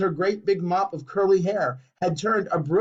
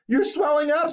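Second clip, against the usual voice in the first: scream; 11 semitones higher than usual. A narrator's reading. Mother screaming again at her swelling daughter, high. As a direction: shriek it again. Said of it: "screamed Miss, Missus Beauregard again".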